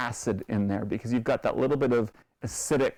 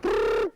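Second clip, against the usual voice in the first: high buzzing blender imitation; food processor noise. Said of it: "and I went".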